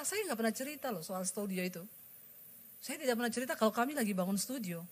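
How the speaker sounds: usual speaking voice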